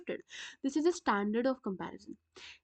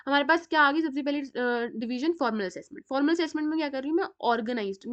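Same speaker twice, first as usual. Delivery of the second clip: ordinary talk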